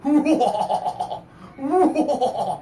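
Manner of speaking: loud booming laugh; booming giant laugh. Acted. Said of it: "Itu dah suara ketawa".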